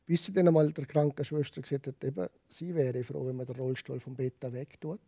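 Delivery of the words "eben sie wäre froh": ordinary delivery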